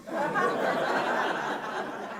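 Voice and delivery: airy, silent giggle; wheezing laugh at his own punchline; high breathless laugh. Real, not acted.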